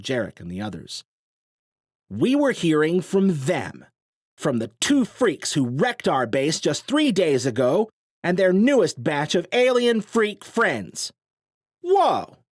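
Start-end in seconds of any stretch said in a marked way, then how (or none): 2.1-11.1 slow, loud, high contemptuous tirade; scornful accusation. A narrator's reading. Sloan denouncing them to crowd. 11.8-12.4 very high, loud exclamation; startled cry. A narrator's reading. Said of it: "Jarek cried".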